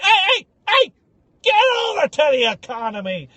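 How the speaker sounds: high sharp shout of an airport agent; an airline agent shouting at a passenger who steps out of line; agent yelling. Retold or imitated. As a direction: bark him back into the economy line.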